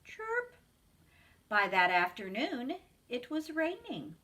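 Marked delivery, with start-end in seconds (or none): 0.0-0.6 high but soft cricket chirp; a small, subdued chirp; quiet cricket chirp. Acted. Narrator voicing the quieter cricket, soft high chirp. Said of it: "he was much quieter on that chilly day".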